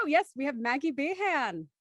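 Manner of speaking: usual speaking voice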